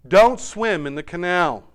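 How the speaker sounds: loud, firm father's warning; fatherly order. A narrator's reading. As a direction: order your son firmly. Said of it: "ordered a father".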